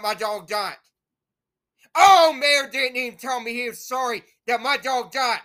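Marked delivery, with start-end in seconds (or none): 1.9-5.4 louder, bitter burst at the mayor; angry outburst. Real, not acted. Ranting at the mayor over his dog, angry outburst. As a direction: throw the blame out hard.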